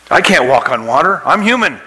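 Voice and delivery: lightly acted protest of disbelief; mock panic. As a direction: pitch climbing sharply in acted alarm. Peter panicking on the water.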